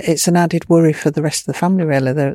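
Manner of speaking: usual speaking voice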